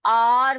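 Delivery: emphatic long आर, no h; आर as an open "aar" with silent h; correct pronunciation. Shown as a demonstration. Teacher drilling pupils on hour, correct pronunciation. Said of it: "कैसे पढ़ेंगे?".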